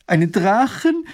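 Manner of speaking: high, loud shriek of a question; horrified cry. A narrator's reading. Roofer's wife hears of a dragon, horrified cry. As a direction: cry out aghast, voice shooting up. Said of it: "schreit Mathilde entsetzt auf".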